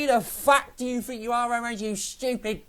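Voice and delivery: retold angry yell at the telly. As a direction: relive yelling at the TV in anger. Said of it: "Shouting at the telly".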